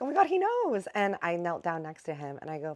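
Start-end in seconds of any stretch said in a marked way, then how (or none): 0.0-0.9 much higher, loud burst of delight; thrilled realisation, pitched way up; excited squeal. Retold or imitated. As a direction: gasp it out like the penny just dropped. Sees grandpa recognise Vegas.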